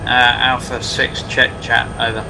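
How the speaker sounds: tinny radio call; calling in over the radio; crackly military radio. Real, not acted.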